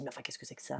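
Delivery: hushed, baffled aside; confused whisper to herself; whispered puzzlement. Retold or imitated. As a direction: whisper it to yourself in disbelief. Puzzled inner thought.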